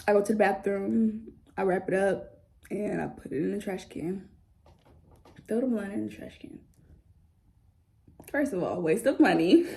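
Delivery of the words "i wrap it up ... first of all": normal talking voice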